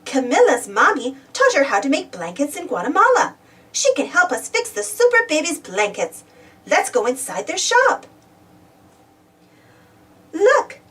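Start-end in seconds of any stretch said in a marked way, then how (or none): none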